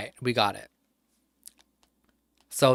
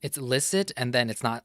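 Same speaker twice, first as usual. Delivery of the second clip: ordinary talk, nothing marked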